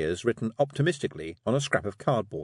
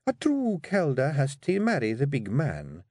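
slow, higher, measured Scottish voice; pointed Scottish wife; 5 semitones higher than usual. Acted. Wife corners Rob about marriage, slow and pointed. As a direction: lay it out slowly, with intent.

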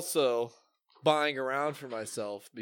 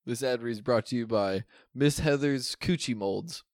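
normal talking voice

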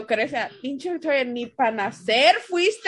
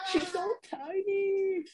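cooing; 6 semitones higher, 7 dB quieter than usual. Real, not acted. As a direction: coo like at a newborn. Cooing over a newborn baby.

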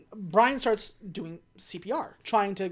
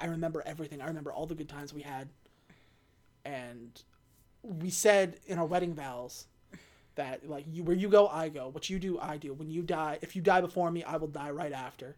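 softer, lower, tender confession; soft tender plea; 4 semitones lower, 6 dB quieter than usual. Retold or imitated. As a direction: murmur it gently over a dying husband. Letty cradles dying Dom.

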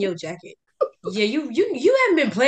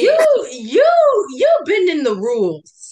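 loud high accusing you; 9 semitones higher, 8 dB louder, slower than usual. Real, not acted. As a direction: call her out loudly. Accusing her of cheating.

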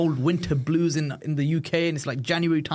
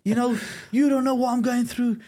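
put-on high, loud shouted teenage tantrum at the parents; 12 semitones higher, 4 dB louder than usual. Teen shouting at his parents after being caught, high loud tantrum. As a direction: yell it like a cornered teen lashing out. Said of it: "I'm just throwing a big tantrum".

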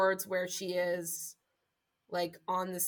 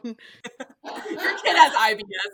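laughing jab; 9 dB louder, slower than usual. Acted. Laughing joke.

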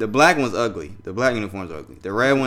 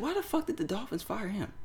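quick inner thought; 12 semitones higher, 9 dB quieter than usual. Retold or imitated. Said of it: "I said it real quick in my head".

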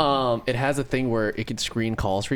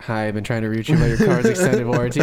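louder canned recording voice, mock robocall; seashell robocall; 5 dB louder than usual. Seashell speaker greeting beachgoers, canned robocall recording. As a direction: read it like a tinny recorded message. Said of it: "where he puts in a seashell".

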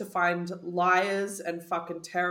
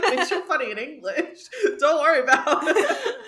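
laughing and louder; 7 semitones higher, 7 dB louder than usual. Retold or imitated. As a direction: crack up through it. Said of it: "I was like".

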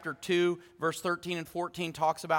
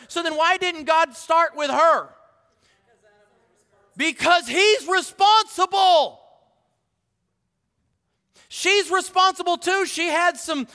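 high, loud preacher's delivery; fired-up preaching; 10 semitones higher, 7 dB louder than usual. Real, not acted. Preacher pressing congregation on who is responsible, fired-up preaching. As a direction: preach it with rising force.

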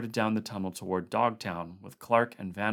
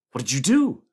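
sudden loud outburst, higher; exploding; 6 semitones higher, 12 dB louder than usual. A narrator's reading. Condit bursting out at MacReady, exploding. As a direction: blurt it out like something snapped. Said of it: "Condit exploded suddenly".

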